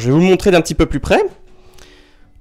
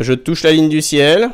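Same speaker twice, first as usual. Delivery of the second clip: ordinary talk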